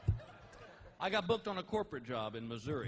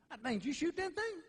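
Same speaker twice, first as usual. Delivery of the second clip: much higher, dopey amazed neighbor; dim neighbor voice; 13 semitones higher than usual. Retold or imitated. Neighbor to comedian about the elk, dopey voice. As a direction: play the dumb neighbor amazed.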